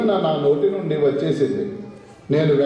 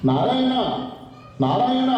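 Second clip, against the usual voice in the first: a sage's call pitched high, rising; high-pitched Narayana; 6 semitones higher than usual. Acted. A sage outside calls to sleepy Vishnu in a high, rising voice. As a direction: sing out the name from high up. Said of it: "mimicry ఆపు".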